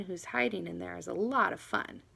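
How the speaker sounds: ordinary delivery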